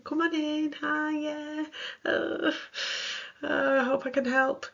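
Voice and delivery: high, hesitant welcome; nervous helper with a hint of panic; timid, unsure. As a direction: sound small and unsure. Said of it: "or you might feel powerless".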